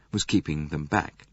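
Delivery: usual speaking voice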